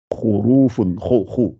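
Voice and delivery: "Khurufun" then "khou, khou" with a rasped kh; full kha sound. Shown as a demonstration. Teacher drills the full kha for students, throaty. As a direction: puff out the kh in "khou, khou". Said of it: "gonflez toujours, hein, le kha".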